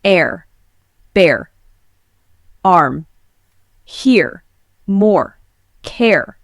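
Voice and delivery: American accent: roll the final R of each word; American accent R demo. Shown as a demonstration. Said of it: "In American English, we say".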